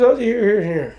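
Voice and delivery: high, loud and bright, pointing the way; quick high hostess call; eager mock clerk. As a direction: play the store clerk eagerly showing the aisle.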